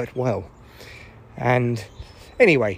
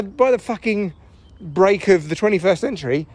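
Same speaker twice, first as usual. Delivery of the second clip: normal talking voice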